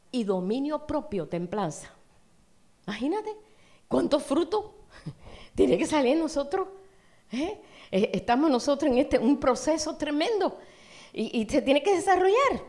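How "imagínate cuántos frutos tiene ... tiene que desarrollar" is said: más alto y fuerte, con fervor creciente; predicación ferviente. Acted. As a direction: predica con entusiasmo, subiendo la voz. Preaching builds to fervour. (pastor exhortando a la congregación con fervor)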